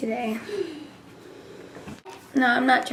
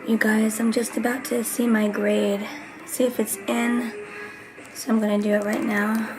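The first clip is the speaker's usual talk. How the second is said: ordinary talk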